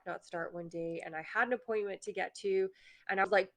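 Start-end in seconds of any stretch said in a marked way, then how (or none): none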